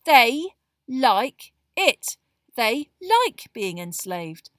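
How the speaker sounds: very loud, slow, word by word; loud Ron. A narrator's reading. Boy insisting elves like being enslaved, loud. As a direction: drum it in loudly and slowly. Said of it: "said Ron loudly".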